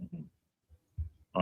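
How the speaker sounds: chewing while humming; humming through a mouthful of food; mouth full. Real, not acted. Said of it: "sorry, I know I'm chewing".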